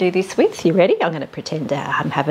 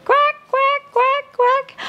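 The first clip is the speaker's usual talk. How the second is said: very high squeaky duck quacks; much slower than usual. Acted. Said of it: "Mother duck said".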